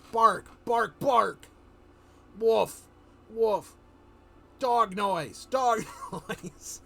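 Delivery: a man faking a bark badly, saying ruff and bark; bad dog bark. Telling viewers how the dog-man faked barking, loud mock bark. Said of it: "He's like".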